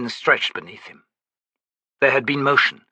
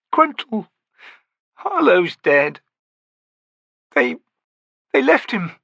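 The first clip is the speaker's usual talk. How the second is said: Stony, grief-stricken; 12 semitones higher than usual. A narrator's reading. Stony telling Gruntle their friends died, grieving. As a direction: pour out the loss, voice cracking.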